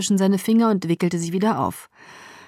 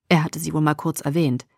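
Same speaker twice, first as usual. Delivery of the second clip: plain reading voice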